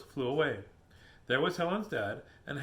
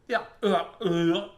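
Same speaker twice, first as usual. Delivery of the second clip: wolf's yell; 3 semitones higher, 7 dB louder, slower than usual. Acted. Wolf sprayed in the face, loud yelling. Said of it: "The wolf yelled".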